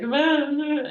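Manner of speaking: high, loud imitated bawling; mock crying wail. Employee crying in the boss's room, exaggerated mock wail. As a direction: wail comically, as if crying all over again. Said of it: "I couldn't stop crying again".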